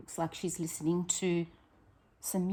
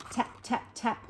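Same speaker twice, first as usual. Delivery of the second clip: soft breathy tap tap tap; whispered tapping; 5 semitones higher, 3 dB quieter than usual. Acted. Handyman Roy fixing things, tapping in a whisper. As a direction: imitate a little hammer softly.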